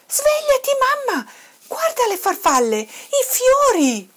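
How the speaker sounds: high, loud little cub calling Mum; excited cry. A narrator's reading. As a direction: shout excitedly to wake your mother. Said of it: "gridò Toddy".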